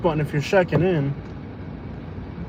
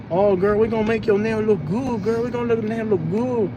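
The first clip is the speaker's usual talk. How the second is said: put-on playful Vietnamese accent, a touch higher and softer; sweet-talking nail salon lady in a light Vietnamese accent; mock Vietnamese accent; 3 semitones higher, 4 dB quieter than usual. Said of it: "they speak Vietnamese accent".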